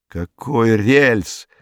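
usual speaking voice

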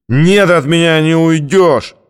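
put-on high, slow drunken bellow; slurred drunk threat. A narrator's reading. Drunk thug bellowing after the narrator, high and slow. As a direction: drag it out, thick with drink.

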